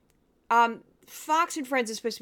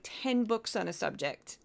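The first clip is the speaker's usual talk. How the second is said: usual speaking voice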